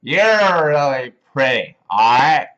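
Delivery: put-on slow, loud slurred drunk; boozy drawn-out pickup lines, words lurching out. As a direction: imitate a drunk clumsily chatting someone up. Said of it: "try to pick up girls when they're drunk".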